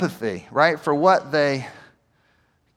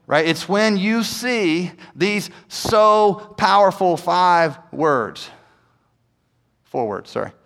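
ordinary talk, nothing marked

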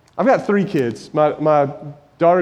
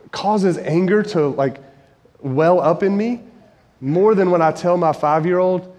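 ordinary talk, nothing marked

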